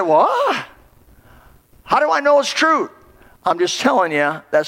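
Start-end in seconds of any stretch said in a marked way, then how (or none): 0.0-2.9 higher, doubting question; incredulous what. Retold or imitated. Preacher voicing a churchgoer doubting God, incredulous and higher. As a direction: push back in disbelief.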